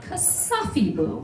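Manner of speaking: voicing the stern mother; imitating Inye. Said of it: "and she goes".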